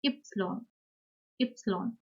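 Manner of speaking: "epsilon" as German Y, ÜP-si-lon; German letter name. Shown as a demonstration. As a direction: say "epsilon" as Ypsilon, starting on a short ü-like vowel. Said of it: "Y is pronounced as".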